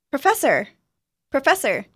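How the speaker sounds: put-on thrilled to spot her old teacher; excited young woman.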